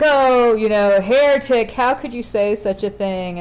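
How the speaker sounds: hammed-up shock at the heretic; mock outrage. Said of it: "everyone's just like".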